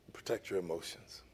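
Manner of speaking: soft, low urging to the congregation; soft instruction. Shown as a demonstration. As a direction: ease it down gently. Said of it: "repeated instruction".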